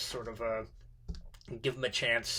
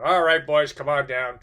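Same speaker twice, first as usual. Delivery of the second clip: calling out; 10 dB louder than usual. Retold or imitated.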